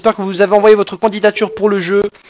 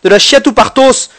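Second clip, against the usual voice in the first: loud, high pompous doctor; mock doctor voice; 8 semitones higher, 7 dB louder than usual. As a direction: announce it like a hammy doctor. Mock doctor diagnosis, shouting. (voix de docteur outrée)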